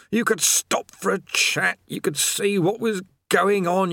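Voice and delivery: weary prisoner griping; haggard chained man. A narrator's reading.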